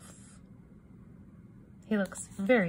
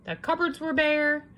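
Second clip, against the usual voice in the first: usual speaking voice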